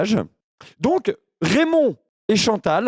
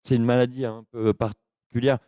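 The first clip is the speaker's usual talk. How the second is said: low, halting, lonely oddball; dopey confused voice; 7 semitones lower, 4 dB quieter than usual. Acted. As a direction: play a muddled lonely man rambling. Keeps confused caller character.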